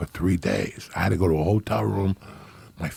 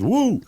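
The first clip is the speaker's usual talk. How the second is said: imitated whoop; 14 semitones higher than usual. Johnny bursts out of the bathroom after hours locked in, very high mock whoop. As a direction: shriek it like a man freed after hours locked in. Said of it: "That's the noise he made".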